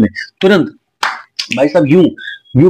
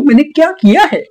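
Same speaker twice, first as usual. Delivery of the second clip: high, whiny mimicry of her innocent protest; squeaky mock-innocent girlfriend voice; mocking Neha; 13 semitones higher than usual. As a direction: parody her playing innocent, pitched way up. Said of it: "तुम कहती हो".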